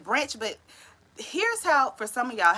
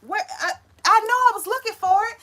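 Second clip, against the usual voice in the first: higher, louder mock fluster; frantic search; 12 semitones higher, 8 dB louder than usual. Stoner searching for the item in hand, mock panic. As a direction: ham up a panicked hunt for something. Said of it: "how you going".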